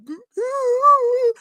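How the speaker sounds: high, hammed-up fake sobbing; a put-on wail mocking their tears; mock boo-hoo. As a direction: imitate a blubbering crybaby. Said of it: "I'm talking about boo-hooing".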